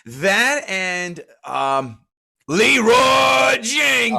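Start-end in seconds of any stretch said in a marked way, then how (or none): 2.5-4.2 long, high, loud yell; drawn-out mock war cry; imitated battle cry. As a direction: stretch the name into a charging holler. Imitating a famous viral battle cry.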